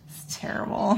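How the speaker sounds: ordinary delivery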